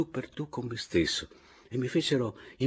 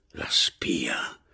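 conspiratorial whisper; hushed, breath-only murmur; 8 semitones lower than usual. A narrator's reading. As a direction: whisper it as a secret aside. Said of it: "mi sussurrò la signorina Caporale".

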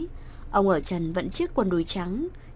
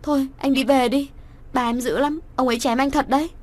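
higher, louder urgent call; 4 semitones higher, 4 dB louder than usual. A narrator's reading. Daughter shouts at her lover to run away, loud and high. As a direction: shout a warning to him. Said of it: "Cô quay sang nhị la to".

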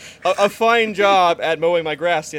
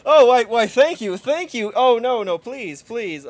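mock gushing thanks; 4 semitones higher, 5 dB louder, slower than usual. Mock acceptance speech at award.

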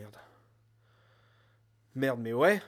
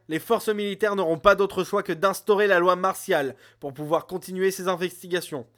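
commanding speech; 6 semitones higher, 8 dB louder than usual. Acted. Acts commanding council speaker.

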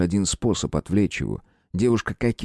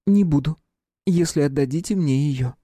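princess voice; 7 semitones higher than usual. A narrator's reading. Princess bargains with hunter.

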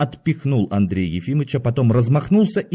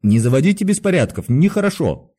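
lecturing guard, raised pitch; admonishing; 5 semitones higher, 3 dB louder than usual. A narrator's reading. Guard lectures a restless patient, admonishing. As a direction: scold him like an unruly pupil. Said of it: "сказал наставительно Никита".

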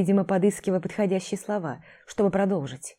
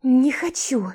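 stubborn refusal; 7 semitones higher, 3 dB louder, slower than usual. Acted. Vika refusing to undress.

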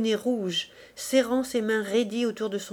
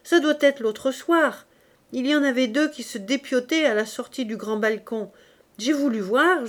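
lively Clémence; 3 semitones higher, 5 dB louder than usual. Acted. Clémence gossips to the women about the fight. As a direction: relish the gory street fight.

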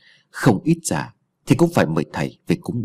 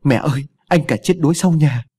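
higher, louder voice, close to tears; a young child blurting bad news, half-sobbing; tearful child; 5 semitones higher, 3 dB louder than usual. Youngest child waking mother with news of drowning, tearful. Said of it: "giọng mếu máo".

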